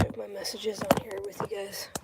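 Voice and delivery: unvoiced hushed aside; whisper. Real, not acted. Vlogger checking messages with viewers, whispering. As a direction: whisper it to the camera.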